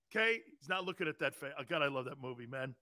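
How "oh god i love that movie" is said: ordinary talk, nothing marked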